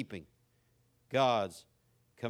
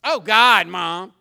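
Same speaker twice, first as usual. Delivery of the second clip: mocking exclamation; 9 dB louder, much slower than usual. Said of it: "I hear people".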